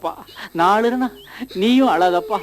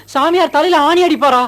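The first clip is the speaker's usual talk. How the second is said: higher and louder alarmed question; shocked disbelief; 8 semitones higher, 4 dB louder than usual. Acted. Son-in-law horrified that the priest drives nails, shocked. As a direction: gasp it out in horror.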